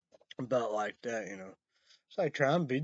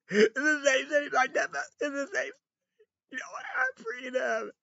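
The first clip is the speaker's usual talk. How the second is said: mock whine; 6 dB louder than usual. Mock whiny complaint.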